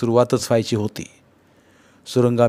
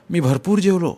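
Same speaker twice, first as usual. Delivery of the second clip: usual speaking voice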